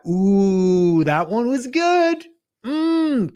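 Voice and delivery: high, slow pleased ooh; delighted. Real, not acted. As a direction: savour your own clever idea. Pleased with his own idea.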